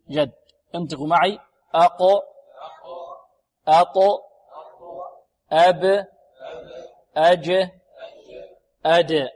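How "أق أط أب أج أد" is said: each of "أق، أط، أب، أج، أد" ends in a strong bouncing release; clear qalqalah. Shown as a demonstration. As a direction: pop the final consonant in "أق، أط، أب، أج، أد", one at a time. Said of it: "لابد أن نأتي بها قلقلة واضحة". (قلقلة قوية واضحة في أواخر "أق، أط، أب، أج، أد")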